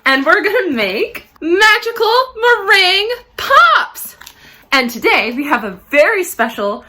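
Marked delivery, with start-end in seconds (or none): 0.1-4.2 exaggerated cartoon intonation. Kids cooking show host intro.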